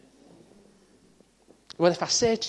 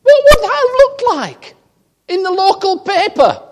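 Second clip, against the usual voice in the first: much higher, loud exasperated voice; hammed-up wife telling him off in the car; mock wife; 15 dB louder than usual. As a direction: play the wife scolding him in falsetto. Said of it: "She says".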